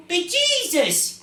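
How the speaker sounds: high, loud, drawn-out Irish exclamation; Irish accent. Acted. Storyteller as the hill wobbles, loud Irish accent. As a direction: cry it out slowly in hammed-up Irish. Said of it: "we'll be doing it in an Irish accent".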